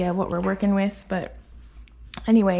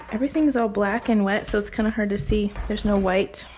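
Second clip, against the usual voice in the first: normal talking voice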